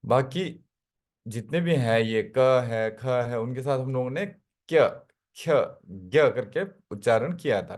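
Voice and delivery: ordinary talk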